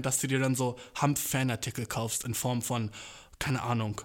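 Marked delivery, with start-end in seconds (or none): none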